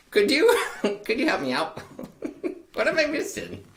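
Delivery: ordinary talk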